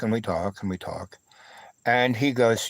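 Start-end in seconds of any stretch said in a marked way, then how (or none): 0.0-1.2 repeated phrase trailing off much softer; fading away. Real, not acted. Telling of the long talk, the repeat fading softer. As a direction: let it fade to almost nothing.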